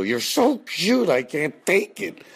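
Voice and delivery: high, slow, squealing gush; gushing over a cute kid. Retold or imitated. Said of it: "I'd wanna go".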